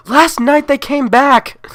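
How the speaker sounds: slow, high, very loud wail; a mother shrieking into the phone; hysterical scream. Acted. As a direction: scream it at the dispatcher. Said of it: "need you to calm down".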